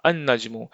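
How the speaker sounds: अन-नज्मु with a held double n; no L, lean on the n in अन-नज्मु; silent L. Shown as a demonstration. Teacher repeats for the learners, अन-नज्मु with doubled n. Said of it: "इसको हम पढ़ेंगे".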